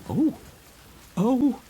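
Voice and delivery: high startled oh, oh; frightened cry. A narrator's reading. Trinculo crying out in fear at the strange monster. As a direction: yelp in fright. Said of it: "cries Trinculo".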